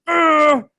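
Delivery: exasperated "ugh" growl, re-enacted; mock angry growl. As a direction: let out a frustrated growl, playing mad. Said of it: "and I'm mad".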